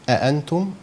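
أأنتم with the second hamza softened between hamza and fatha; eased hamza. Shown as a demonstration. Teacher demonstrating the eased hamza in أأنتم to students. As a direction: blur the second hamza of أأنتم toward a plain vowel. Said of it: "بين الهمزة والفتحة، لا هي همزة ولا هي فتحة".

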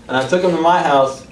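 ordinary talk, nothing marked

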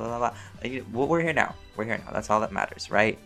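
ordinary talk, nothing marked

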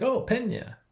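"Pena" said high and bright with the Italian sound, the way the local says it; correct Italian Pena. Retold or imitated. Lecturer retelling an Italian local correcting his pronunciation, mock-cheerful. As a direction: chirp "Oh, Pena" like a friendly local correcting you. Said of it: "they're like".

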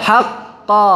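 usual speaking voice

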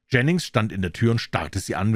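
ordinary delivery

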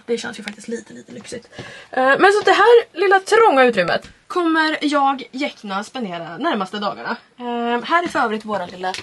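ordinary talk, nothing marked

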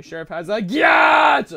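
excited shout. Real, not acted. Shouted game exclamation.

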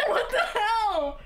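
high loud laughing; slow laughing disbelief. Real, not acted. Laughing after the scare.

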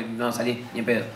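mock disgust at niece's kiss; disgusted refusal. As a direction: recoil from the kiss.

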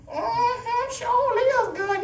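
mock pleased reader. Mimicking horoscope reader approving.

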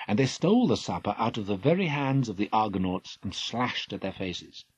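ordinary delivery, nothing marked

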